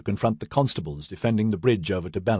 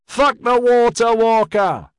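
high, loud, slow yell from the crowd; 13 semitones higher, 7 dB louder, slower than usual. A narrator's reading. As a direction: scream it from the mob. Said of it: "someone unseen yelled".

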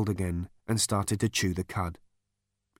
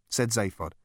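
ordinary delivery, nothing marked

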